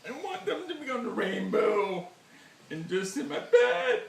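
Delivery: high, wailing mock sobs; hammed-up weeping. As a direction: wail each wish through fake tears. Overcome, listing wishes for beer.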